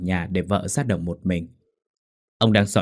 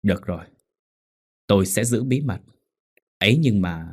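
through gritted teeth. A narrator's reading. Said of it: "mà nghiến răng đáp".

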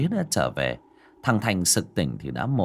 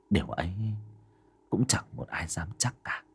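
whisper; 3 semitones lower, 7 dB quieter than usual. A narrator's reading. Ominous narration of unknown ghost.

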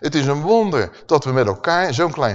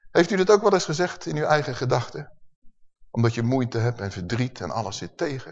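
ordinary talk, nothing marked

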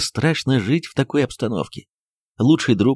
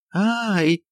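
high frightened cry; scream in a strange voice; 9 semitones higher than usual. Acted. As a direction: shriek in sudden terror. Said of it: "не своим голосом закричала". (вскрик не своим голосом, высоко)

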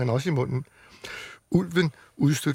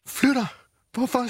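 shocked; 9 semitones higher than usual. Acted. Shocked child hearing friend moves.

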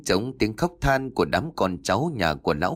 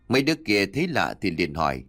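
usual speaking voice

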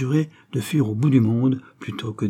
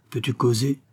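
plain reading voice

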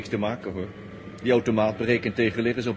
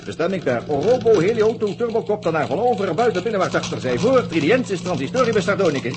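louder, higher, reeling off nonsense data; loud rapid-fire commands; 5 semitones higher, 5 dB louder than usual. Acted. Jumbled codes shouted at a gate robot to overload it. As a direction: bark the garbled data at the gate machine.

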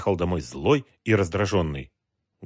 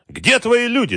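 much higher, louder angry yell; 7 dB louder than usual. A narrator's reading. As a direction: bellow it back at him. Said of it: "заорал в ответ Галкин". (злобный крик)

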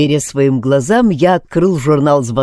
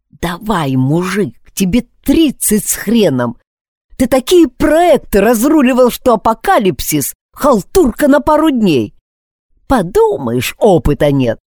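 high, strained self-encouragement; nervous pep talk; 7 semitones higher than usual. Acted. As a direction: pump yourself up while panicking.

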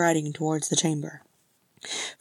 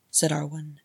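usual speaking voice

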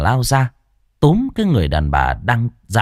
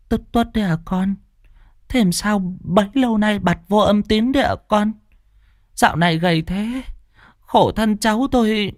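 tender greeting; 9 semitones higher than usual. Acted. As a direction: greet him with pity and love. Aunt greeting long-lost nephew.

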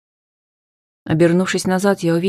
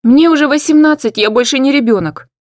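much higher and louder shout; furious yell; 8 semitones higher, 7 dB louder than usual. Acted. Hanna yelling at her father that she's grown, shrill and loud. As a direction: fling it at him in rage.

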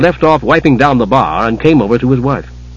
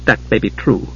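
crisply; 3 semitones lower, 5 dB quieter than usual. Acted. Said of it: "said Tika crisply".

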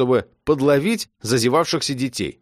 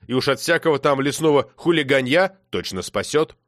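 ordinary delivery, nothing marked

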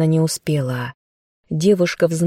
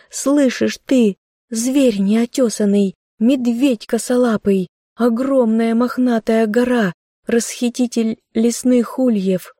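higher, tearful scolding cry; shouting; 6 semitones higher than usual. Acted. Girl shouts insults at her dead bear in grief. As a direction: rail at him through despair. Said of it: "Заряна громко кричала на медведя".

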